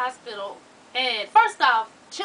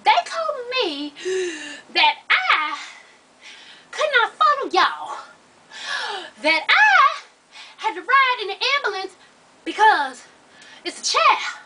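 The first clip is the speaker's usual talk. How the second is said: high, loud, acted breathlessness; out of breath, panting; 9 semitones higher, 7 dB louder, slower than usual. Mother winded from running confronts the staff, panting hard. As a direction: huff out each slow phrase between big breaths. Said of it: "I'm still breathing hard".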